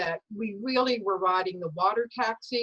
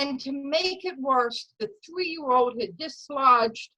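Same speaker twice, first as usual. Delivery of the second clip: ordinary talk, nothing marked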